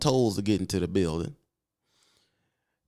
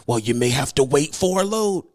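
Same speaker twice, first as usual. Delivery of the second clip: dispatcher voice; 4 semitones higher, 4 dB louder than usual. Shown as a demonstration. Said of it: "this is how it sounds".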